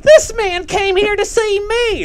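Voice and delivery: high, loud mock yell; shrieked impression of a yelling aunt; imitating aunt's yelling. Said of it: "there was your Aunt Charlotte yelling".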